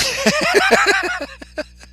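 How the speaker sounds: high-pitched burst of laughter; amused laugh at a chat message. Real, not acted.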